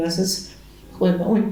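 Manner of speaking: normal talking voice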